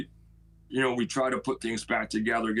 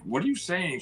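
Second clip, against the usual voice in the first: mildly startled, higher-pitched challenge; retold shocked question; 7 semitones higher than usual. Neighbor challenged over a terrible wish about her son, mildly shocked. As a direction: voice jumps up in retold disbelief.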